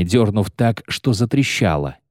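ordinary delivery

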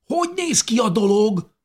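high, eager call from below; excited question. A narrator's reading. Dávid calling up from the cave, excited. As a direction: ask it bursting with curiosity. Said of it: "Dávid izgatott hangját".

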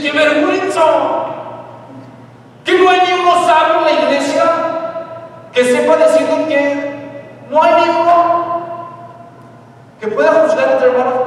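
loud, high shouted rebuke; indignant outburst. Acted. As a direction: shame them at full volume.

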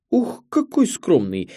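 high, drawn-out mock reproach; playful scolding. A narrator's reading. Director teasing the modest young filmmaker, playful scolding. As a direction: wag a finger at false modesty, pitched well up. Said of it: "Директор грозит Рашиду пальцем".

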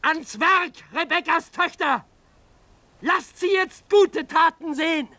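loud rallying order to the crowd; commanding shout. Acted. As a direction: rouse the mob into action.

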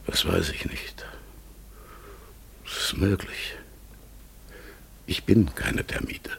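breathy whisper, barely voiced. Raven creature whispering its reply to the visitors. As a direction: hush it to a secret whisper. Raven answers questions mysteriously.

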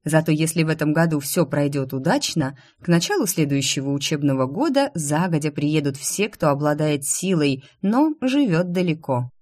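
plain reading voice

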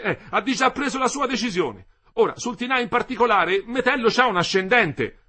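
put-on high, loud, worked-up young man; agitated young man. A narrator's reading. Young Renzoni explains himself to Ersilia, agitated. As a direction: pitch it right up, agitation barely mastered.

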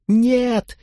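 put-on very high little boy's squeal; acted wail of a sobbing child; child's tearful shriek. A narrator's reading. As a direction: squeal it in protest, pitch far up. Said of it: "верещал, заходясь в плаче".

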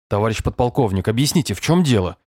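usual speaking voice

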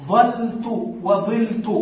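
ظلت said slowly, first with fatha ظَلت then with kasra ظِلت. Shown as a demonstration. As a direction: slowly contrast ظَلت and ظِلت, open then broken vowel. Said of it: "بالفتح والكسر". (نطق بطيء بالفتح ثم الكسر)

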